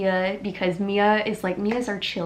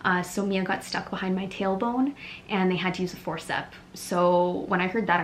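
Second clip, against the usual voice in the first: usual speaking voice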